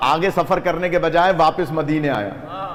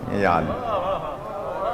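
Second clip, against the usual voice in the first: pained cry; 10 semitones lower, 8 dB quieter, much slower than usual. Acted. Preacher's pained cry mid-story.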